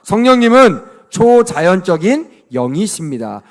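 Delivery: put-on slow, loud read-along line for the kids to repeat; loud lead reading. Shown as a demonstration. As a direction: say it big and slow so they can follow. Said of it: "성령님을 따라서 읽어봅시다".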